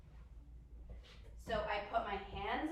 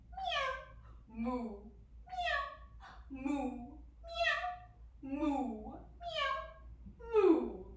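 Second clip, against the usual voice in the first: quicker cat and cow; 2 semitones higher, much slower than usual. Shown as a demonstration. Teacher speeding up cat-cow with children, quick meows and moos. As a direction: switch briskly between cat and cow calls. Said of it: "do it a little quicker".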